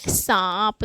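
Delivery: सांप with long nasal आ, released प; nasalised vowel demo. Shown as a demonstration. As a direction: stretch सांप through mouth and nose. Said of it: "मुंह और नाक दोनों पे बल जा रहा है".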